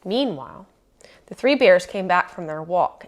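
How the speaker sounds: plain reading voice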